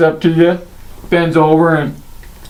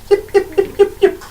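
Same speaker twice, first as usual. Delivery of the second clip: high, tiny kobold yips; yipping kobolds. Acted. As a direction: yap like a pack of little kobolds. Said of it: "As they're like".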